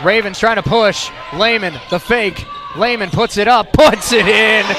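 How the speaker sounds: excited play-by-play. Real, not acted. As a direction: call the scoring burst with energy. Live play-by-play call.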